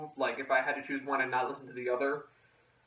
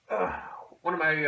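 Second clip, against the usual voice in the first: ordinary delivery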